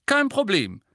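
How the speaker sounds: high, loud brusque answer; gruff teacher, curt. A narrator's reading. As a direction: brush him off briskly. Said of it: "erwiderte Professor Raue Pritsche barsch". (barsch und laut)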